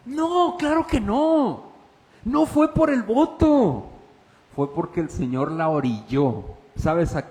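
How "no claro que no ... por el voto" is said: high, slow, impassioned preacher's cry; fiery pulpit emphasis; preaching at full force. Acted. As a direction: drive the answer home to the congregation. Pastor preaching to congregation.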